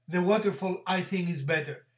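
ordinary talk

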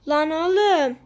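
loud protest at an opponent; exasperated yell. Real, not acted. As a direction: call out sharply in disbelief. Gamer protests during play. (bıkkınlıkla bağırarak)

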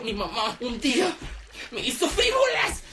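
remember mom with giddy love; boy gushing over his mother; tender glee. Retold or imitated. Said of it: "Y yo".

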